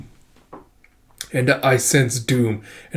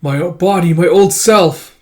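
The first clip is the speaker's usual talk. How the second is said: a loud high No trailing into slow grief; anguished cry; 5 semitones higher, 7 dB louder, slower than usual. Acted. Flying in to reclaim his old body, anguished shout. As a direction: cry out over the lost old self. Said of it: "He goes flying in with a".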